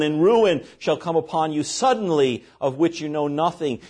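ordinary delivery

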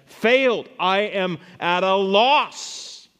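loud, high cry with slow, stretched ending; declaimed lament. Acted. Preacher voices a ruined modern Habakkuk, loud lament. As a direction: build the disaster to a projected climax.

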